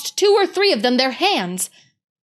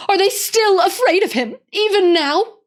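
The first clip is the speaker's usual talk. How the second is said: much higher, louder distressed question; tearful girl; 10 semitones higher, 5 dB louder than usual. Acted. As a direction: ask in tearful disbelief. Susan weeping over Aslan.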